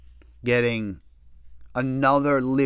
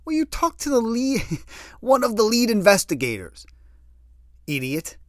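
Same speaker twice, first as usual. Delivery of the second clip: high, louder mock berating; exasperated scolding; 11 semitones higher, 5 dB louder than usual. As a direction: lay into him like a fed-up girlfriend. Girlfriend berates him, quoted.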